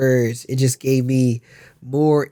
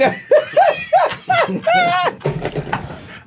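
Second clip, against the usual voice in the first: high laugh. Real, not acted. Friends laughing together.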